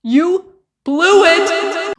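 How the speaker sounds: high, very drawn-out words; taunting verdict. Real, not acted. Commentator judging the failed dad meeting, drawn-out taunt. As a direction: stretch each word out at a high pitch. Scolds girlfriend for ruined meeting.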